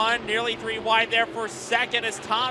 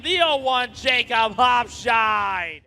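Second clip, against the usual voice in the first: drawn-out arena shout; announcer shout; 5 dB louder, slower than usual. Real, not acted. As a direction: belt the winner's name slowly. Winner announcement to crowd.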